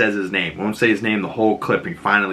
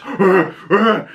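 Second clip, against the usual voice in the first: high, loud mock ape grunts; ape noises; 9 semitones higher, 4 dB louder than usual. Imitating Frank eating a punch, ape grunts. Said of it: "ape noises".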